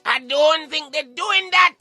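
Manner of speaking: ordinary talk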